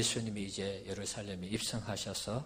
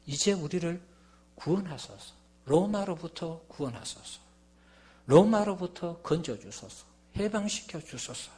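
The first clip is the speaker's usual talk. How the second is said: raised-pitch plea, not fully shouted; imitated crowd cry; 6 semitones higher, 4 dB louder than usual. Preacher voicing the crowd crying to Jesus for liberation, imploring. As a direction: voice the crowd's appeal for rescue. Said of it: "이렇게 외쳤던 것입니다".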